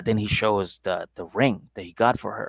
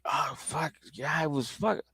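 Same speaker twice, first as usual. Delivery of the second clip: put-on sleepy boyfriend; 7 semitones higher than usual. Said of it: "He's like".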